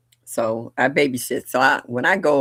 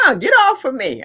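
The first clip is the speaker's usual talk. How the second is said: loud, high mock protest; playfully outraged retold cry; mock rebuff; 11 semitones higher, 7 dB louder than usual. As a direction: shoo off a clingy child, loud and pitched up. Acting out pushing clingy child away.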